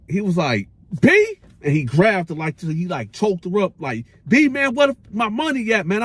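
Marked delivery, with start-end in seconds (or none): 1.0-1.4 high, loud, incredulous; disbelieving squeak. Retold or imitated. Roach stunned the sister asks what money. 4.3-6.0 higher, pressing demand; mock threat. Roach choking the sister, demanding his money.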